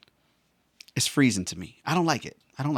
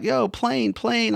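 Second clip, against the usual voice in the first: put-on much higher-pitched excited kid; 10 semitones higher, much slower than usual. Kid pointing out airplanes, excited and high-pitched. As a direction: imitate a kid spotting planes. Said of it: "when I was a kid".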